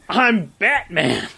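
mock Batman delivery. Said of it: "that's when he's like".

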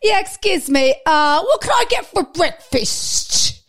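high, loud mocking English producer, slow; posh producer impression. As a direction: drawl it like an entitled client. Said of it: "You're like".

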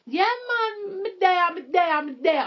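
high, loud street slang voice. Pretend.

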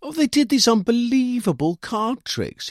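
high, loud, wide-eyed voice; earnest young man. A narrator's reading. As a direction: tell it in earnest wonder. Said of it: "he told me earnestly".

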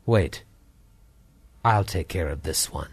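low mysterious voice. Acted. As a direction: say it low and cool. Mysterious voice from back.

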